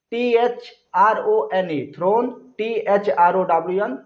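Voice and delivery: letters spelled out slowly; slow, deliberate spelling for children. Shown as a demonstration. Teacher spells word for class.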